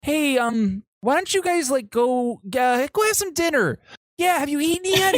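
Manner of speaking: very high, strained friendly voice; nervous fake casual. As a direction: cover panic with forced cheer. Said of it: "He goes".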